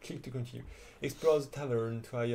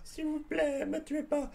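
pleading. Acted. Pleading pirate voiced in game.

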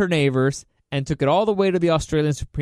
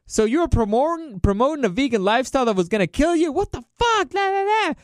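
high-pitched indignant crowd imitation; mock outrage; 9 semitones higher than usual. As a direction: rise into squealing, exasperated disbelief. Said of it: "everybody was like".

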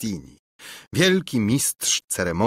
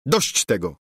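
interrupting; 4 semitones higher than usual. Acted. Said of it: "przerwał Lulejka".

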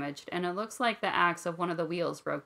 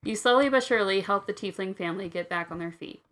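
ordinary talk

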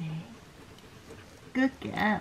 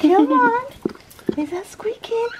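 higher sing-song coaxing; 9 semitones higher than usual. Real, not acted. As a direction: gently urge the pup on. Coaxing newborn puppy.

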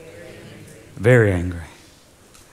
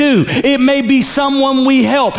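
ordinary talk

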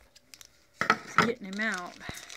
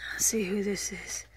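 whisper; 4 dB quieter than usual. Real, not acted. Whispering while unboxing.

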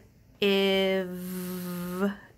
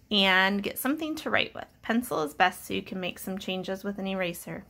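ordinary delivery

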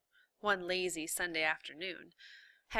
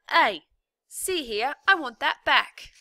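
loud, higher shout of protest; prisoner protesting; 7 semitones higher, 13 dB louder than usual. A narrator's reading. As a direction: yell after the thief.

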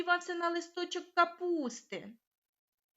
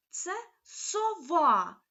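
usual speaking voice

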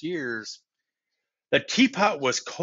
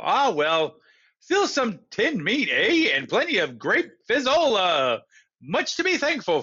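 higher, forced cheerful general; 6 semitones higher, 3 dB louder than usual. Acted. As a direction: put on a brave, bright face. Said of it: "App tried to be cheerful".

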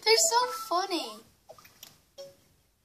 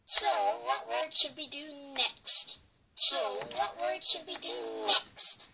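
eerie backwards-played speech; speech reversed twice, warped; creepy reversed voice. Shown as a demonstration. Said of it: "That is really creepy".

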